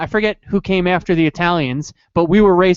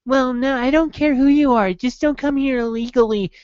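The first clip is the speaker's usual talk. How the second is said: higher, mock-reasonable voice; 6 semitones higher than usual. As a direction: sound falsely polite and reasonable. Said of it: "Nowadays, like".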